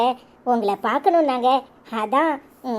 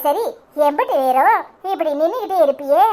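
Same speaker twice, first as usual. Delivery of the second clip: usual speaking voice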